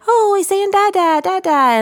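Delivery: put-on a thrilled father hearing baby babble; proud daddy voice. Daddy telling the mom the baby says da, high proud voice. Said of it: "I'll hear daddy say".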